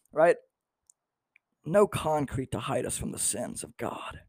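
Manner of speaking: ordinary delivery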